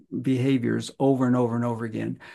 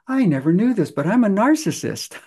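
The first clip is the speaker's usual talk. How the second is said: higher, amazed admission; startled confession; 7 semitones higher than usual. Retold or imitated. Teen client confessing his discovery, surprised. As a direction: blurt out the discovery. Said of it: "he says".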